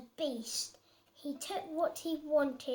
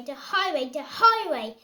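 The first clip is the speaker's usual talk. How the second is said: building refrain; 9 dB louder than usual. Acted. Child reading refrain with building force.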